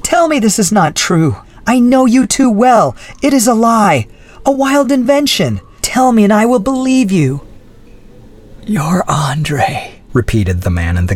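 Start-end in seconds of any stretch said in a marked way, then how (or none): 0.0-7.4 much higher, pleading cry; desperate plea. Acted. Adrienne pleading with Andre, high and desperate. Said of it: "cried Adrienne". 8.6-9.9 whispered. Acted. Andre echoing Adrienne, whispered. Prisoner repeats softly.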